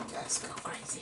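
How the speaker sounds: soft whispered aside; whispering. Real, not acted. Painter scrubbing in colour with viewers, whispering. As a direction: whisper it conspiratorially.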